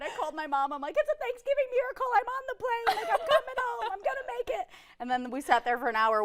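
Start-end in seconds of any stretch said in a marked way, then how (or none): 1.0-4.7 mock-ecstatic, very high-pitched voice; overjoyed. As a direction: squeal the good news to mom, hammed up. Acted joyful phone call to mom.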